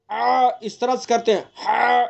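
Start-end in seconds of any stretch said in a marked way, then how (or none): 1.7-2.0 choked, forced throaty ha; strained ha. Shown as a demonstration. Teacher shows students the wrong strangled ha, forced. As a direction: push a harsh ha from a squeezed throat. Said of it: "اس طرح کی آواز نکال کر کے آپ کو بتا رہا ہوں".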